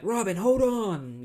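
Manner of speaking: high, loud, drawn-out mock hero call; imitated urgent call. Batman calls to Robin under attack, mock urgency. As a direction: call out as if across a crowd.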